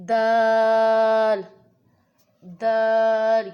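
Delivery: دال with the vowel held very long and loud, four counts; long madd on dal. Shown as a demonstration. Teacher drawing out دال for Quran students. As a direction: stretch دال out slowly. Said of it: "اس میں بھی جو ہے مد کا اضافہ".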